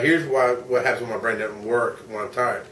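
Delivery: ordinary delivery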